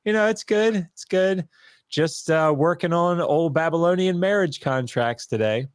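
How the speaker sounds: high, bright-pitched forced cheer; nervous chirpy small talk. Retold or imitated. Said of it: "I was like".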